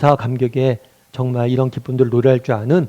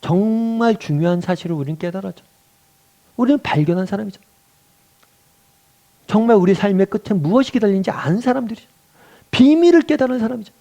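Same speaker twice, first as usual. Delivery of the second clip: preaching that climbs higher to a peak; building sermon fervour; 7 semitones higher than usual. Real, not acted. Preacher reminding congregation they discovered the secret, fervour building. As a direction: build line by line to a high, emphatic finish.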